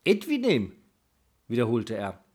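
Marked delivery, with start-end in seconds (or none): 0.0-0.7 surprised repeat. Acted. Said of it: "Mit einem Schlag war Thuri hellwach".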